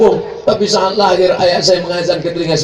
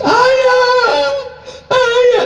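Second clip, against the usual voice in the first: crying. Retold or imitated. Child crying over father in the ICU, mock wail. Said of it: "sambil menangis".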